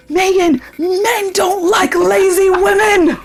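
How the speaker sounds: high-pitched mock yell of a scolding dad, drawn out; retold yelling. Daughter imitates dad scolding her as a teen, mock yelling. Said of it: "yelling at me and telling me".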